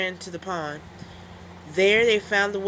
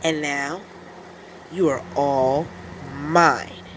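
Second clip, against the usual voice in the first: lower, menacing monster voice; menacing creature; 4 semitones lower, slower than usual. Acted. Creature threatens the wolf, low menacing voice. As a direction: gloat menacingly. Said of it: "said the boy".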